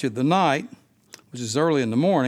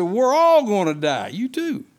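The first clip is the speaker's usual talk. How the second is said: louder, higher cry that everyone will die; mock panic, louder and higher; mock panicked cry; 5 semitones higher, 4 dB louder than usual. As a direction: cry out in alarm, stressing all. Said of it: "They said".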